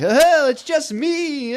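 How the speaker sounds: high, slow silly clown voice; drawn-out goofy clown squeal. Retold or imitated. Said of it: "Hit you with clown voice".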